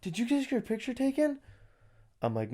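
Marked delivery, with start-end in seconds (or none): 0.0-1.4 high, soft mock-sweet clerk voice; lightly voiced prim clerk, softer; high, quiet put-on clerk politeness; mock polite clerk. Retelling DMV clerk's question.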